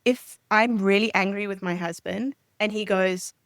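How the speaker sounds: ordinary talk, nothing marked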